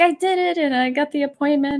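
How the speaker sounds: high-pitched imitation of a thrilled kid; mock excited child's announcement; excited daughter. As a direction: retell a child's proud news, pitched well up. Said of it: "she was so excited".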